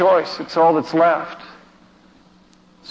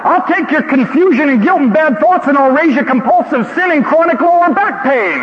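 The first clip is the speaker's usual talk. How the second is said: challenging yell; 11 semitones higher than usual. Retold or imitated. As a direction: yell back topping his list. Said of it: "this voice yelling".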